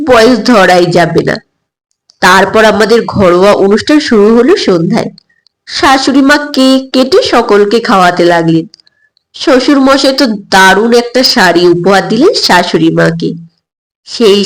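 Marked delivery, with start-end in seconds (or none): none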